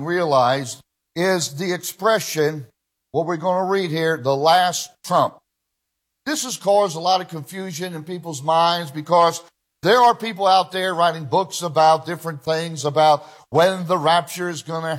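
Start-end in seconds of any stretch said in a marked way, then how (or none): none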